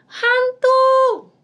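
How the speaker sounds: high, loud cry of fright; loud frightened "Hantu!"; terrified shout. Acted. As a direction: scream it like you've seen a ghost. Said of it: "teriak mereka ketakutan".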